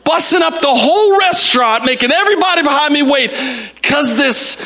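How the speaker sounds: high-pitched, incredulous tirade; emphatic, exasperated self-mockery; mock frustrated rant. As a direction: build in comic outrage at your own behaviour. Mock-frustrated rant building to shout.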